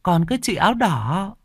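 put-on high childlike voice; young boy telling his mother about the ghosts; little boy. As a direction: speak as an innocent small boy pointing.

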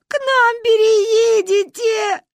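very high, loud, drawn-out cry; tearful consoling wail. A narrator's reading. Girl comforts crying Manya, slow high wail. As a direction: bawl out the comfort slowly.

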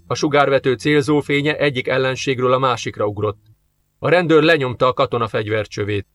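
usual speaking voice

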